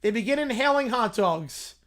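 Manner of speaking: usual speaking voice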